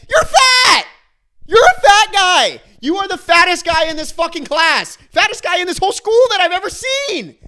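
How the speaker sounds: high, loud screamed insults; furious yelling. Acted. Finally turning around on the classmate, furious shouting. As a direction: explode and shout it at full blast. Imagined outburst at the annoying classmate.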